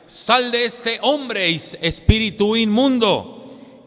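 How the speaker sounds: high, loud, slow command; commanding rebuke. Acted. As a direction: cast the spirit out with authority. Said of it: "Jesús le decía". (Jesús ordenando con fuerza y autoridad)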